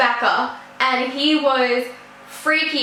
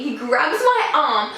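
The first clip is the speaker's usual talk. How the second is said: ordinary delivery, nothing marked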